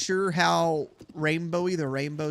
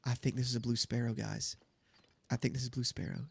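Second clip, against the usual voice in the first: hushed; 5 semitones lower, 6 dB quieter, faster than usual. Real, not acted. Sneaking up on a bird.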